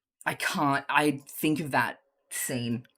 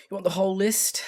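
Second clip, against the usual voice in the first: Crowley voice; 8 semitones higher, 4 dB louder than usual. Acted. Crowley asks, dismayed.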